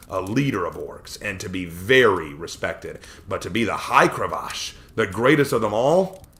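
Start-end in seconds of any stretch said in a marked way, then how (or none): none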